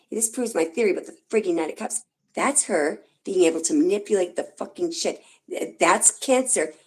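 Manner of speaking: ordinary talk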